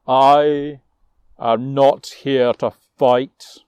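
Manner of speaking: loud, slow, looming giant's challenge; frost giant, booming. Acted. Frost giant challenging the hall, loud and slow.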